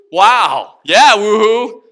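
loud whoop of praise; exultant shouted celebration; jubilant. Real, not acted. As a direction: cheer out loud over good news. Celebrating his new life in sermon.

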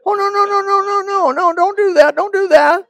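high, loud, fast begging no; mock panicked pleading. Boy begging his mother, high panicked no. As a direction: plead like a scared boy caught out.

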